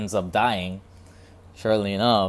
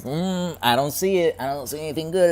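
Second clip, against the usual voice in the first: high, loud, unimpressed voice; dismissive scoff; 9 semitones higher, 6 dB louder than usual. Retold or imitated. Someone ignoring what's in front of them, scoffing. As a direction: brush it off like nothing's there. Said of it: "You're like".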